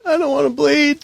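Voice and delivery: high whimpering hostage voice; a frightened captive pleading; scared hostage. Acted.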